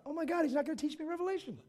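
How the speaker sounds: high-pitched, whiny alarm; mock dismay. Pupil dreads the lesson being over, high mock dismay. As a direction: think it aloud in comic panic. His alarmed thought, acted.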